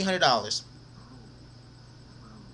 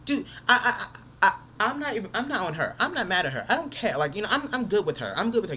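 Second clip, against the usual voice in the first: ordinary delivery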